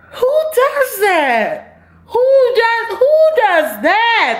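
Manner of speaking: very high, slow, laughing disbelief; incredulous outrage. Real, not acted. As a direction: squeal it like you cannot believe it. Repeated outraged question.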